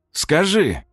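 sharp high command. A narrator's reading. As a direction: bark the order high. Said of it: "скомандовал он".

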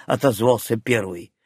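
usual speaking voice

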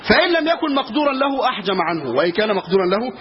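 ordinary delivery

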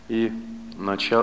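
ordinary talk, nothing marked

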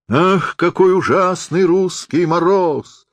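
high, drawn-out theatrical groan of complaint; hoarse lament. A narrator's reading. Freezing Napoleon on stage bemoans the Russian frost, hoarse and drawn-out. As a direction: lament it slowly, a touch hoarse and pitched high. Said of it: "прохрипел он".

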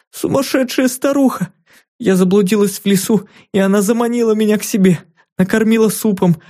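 put-on high, hurried child's voice; a scared girl pouring out her story; frightened little girl. A narrator's reading. Captive girl speaks.

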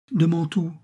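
concentrated terror. A narrator's reading. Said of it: "avec une terreur concentrée".

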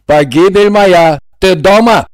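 angry shout. A narrator's reading. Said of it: "Сердито крикнул Игорь".

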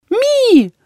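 high squeaky creature war cry; a tiny beast's shrill battle squeak. A narrator's reading. The familiar attacks Jeyna, squeaky battle cry. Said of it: "Раздался боевой клич".

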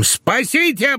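high cry for help. A narrator's reading. Husband tears his ration card, crying out for help. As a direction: cry out high, without extra volume. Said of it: "с криком".

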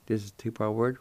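normal talking voice

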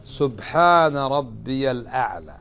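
"سبحان ربي الأعلى" drawn out slowly and softly; slow, careful dhikr recitation; slow model recitation. Shown as a demonstration. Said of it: "فماذا تقول؟".

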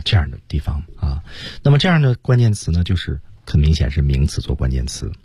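normal talking voice

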